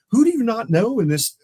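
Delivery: high, loud mock disbelief; exasperated question. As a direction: imitate a fed-up colleague's protest.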